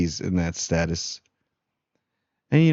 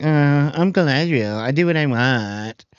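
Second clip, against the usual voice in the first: mock haughty; 6 semitones higher than usual. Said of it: "she's like".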